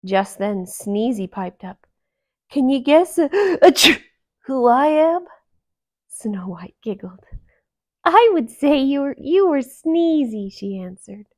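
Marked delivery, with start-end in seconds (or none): 2.5-5.3 loud voice broken by a sneeze; Sneezy interrupting himself with a sneeze; sneezy dwarf. A narrator's reading. 8.0-10.5 put-on higher, loud girlish voice; princess guessing. A narrator's reading.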